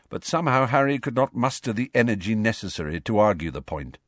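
ordinary delivery

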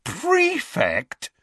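high-pitched, astonished repeat; incredulous. A narrator's reading. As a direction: squeal the word in disbelief. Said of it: "staring incredulously at the letter".

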